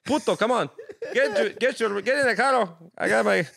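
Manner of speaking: put-on high, loud Dominican accent with laughs slipping in; mock-scolding Dominican driver accent. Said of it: "Why are you so fucking good at accents".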